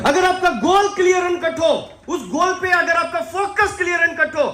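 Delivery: high-pitched, insistent stage exhortation; rousing motivational pitch. Acted. Speaker selling freedom to the audience, rousing exhortation. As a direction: drive the promise home at a high pitch. Drives the moral home.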